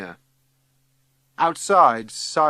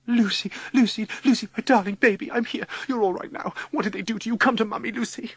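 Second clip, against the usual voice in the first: high, gushing motherly voice; fussing mother; 10 semitones higher, faster than usual. A narrator's reading. Mother rushing to comfort Lucy, gushing high. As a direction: fuss over a darling baby.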